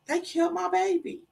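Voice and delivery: high, softened grieving voice; stricken realization. Retold or imitated. Mother realizes her baby was killed, stricken.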